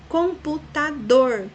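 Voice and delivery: computador slow, loud and high, syllables apart, strong dor; stress on last syllable. Shown as a demonstration. Teacher demonstrating an oxytone, computador stressed on final dor. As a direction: break up computador and hit dor. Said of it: "dor é a última sílaba".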